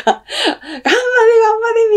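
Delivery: high, stretched-out, wailing "ganbare" chant; hammed-up desperate cheer, drawn out slowly; mock frantic cheering. Mocking a school cheering section chanting "go, go" without thought. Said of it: "みたいな".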